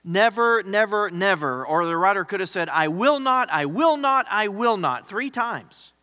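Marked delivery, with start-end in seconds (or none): 0.0-1.6 each NEVER hit loud and high, drawn out; emphatic repetition. Shown as a demonstration. Preacher rendering a Greek triple negation, emphatic. 2.7-5.1 loud, high punch on WILL in each phrase; emphatic refusal. Shown as a demonstration. Preacher showing how the writer could say it, emphatic. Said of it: "it's an emphatic word".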